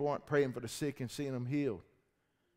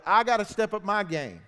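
declared loud; 5 semitones higher, 9 dB louder than usual. Shown as a demonstration.

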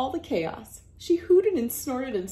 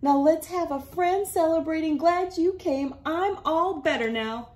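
higher, louder happy granny; joyful granny; 9 semitones higher, 4 dB louder than usual. Acted. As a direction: celebrate with everyone. Granny celebrates.

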